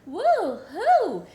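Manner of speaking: high, loud whoop; playful cheer. Acted. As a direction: whoop along for the children. Cheering David's bath play.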